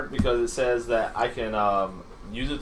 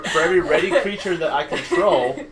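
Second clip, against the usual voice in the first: laughing while talking; 4 semitones higher, 5 dB louder than usual. Real, not acted. Laughing while reading card.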